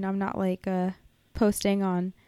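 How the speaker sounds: ordinary talk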